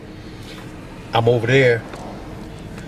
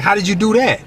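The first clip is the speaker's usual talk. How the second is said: mama scolding; 8 semitones higher than usual. Retold or imitated. Mama scolding him in story.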